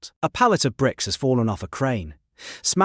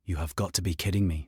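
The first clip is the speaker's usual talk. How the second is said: low, cold and clipped; a quiet icy put-down; cold steel; 4 semitones lower, 6 dB quieter, faster than usual. A narrator's reading. Old rival at her desk, icy disbelief. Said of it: "voice like cold steel".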